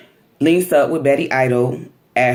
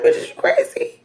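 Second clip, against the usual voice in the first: much higher, outraged disbelief; incredulous shriek. Retold or imitated. Betty rejecting Mama D's offer, incredulous. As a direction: act like she lost her mind.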